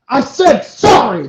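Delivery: loud, high, slow retort; irritated apology. Retold or imitated. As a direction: snap back a grudging apology. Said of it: "like that".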